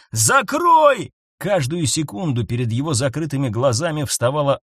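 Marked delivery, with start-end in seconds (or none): 0.1-1.1 high, loud, desperate order; loud, stretched-out cry; shouting. A narrator's reading. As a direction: yell it out in panic. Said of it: "крикнул Фокс".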